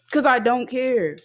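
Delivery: loud, slow and defiant. Real, not acted. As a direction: spell it out loudly, slowly.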